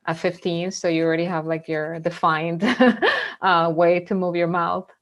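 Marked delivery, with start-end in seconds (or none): 2.7-3.4 quick rising laugh; a bright amused burst of laughter; short laugh. Real, not acted. Guest joking about her accent, short rising laugh.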